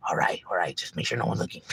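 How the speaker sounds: low hushed whisper of giving in; whispered agreement. Retold or imitated. Cop quietly agreeing to go along with the scheme, whispering. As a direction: mutter it, checking no one hears.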